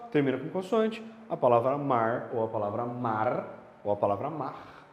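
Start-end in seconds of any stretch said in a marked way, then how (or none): 1.8-2.3 "mar" with a light tongue-tip r at the end; regional R variant. Shown as a demonstration. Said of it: "seja qual for a pronúncia". 2.8-3.5 "mar" with a held, sounded r at the end; regional R variant. Shown as a demonstration. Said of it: "seja qual for a pronúncia". 4.3-4.9 "mar" ending in a throaty h-like r; guttural R variant. Shown as a demonstration. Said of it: "seja qual for a pronúncia".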